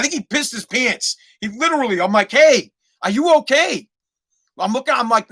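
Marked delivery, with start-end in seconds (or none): 2.3-3.9 raised, higher retold call. Driver checking on the frozen kid, calling out. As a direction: call it out a little louder. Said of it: "I'm yelling out the".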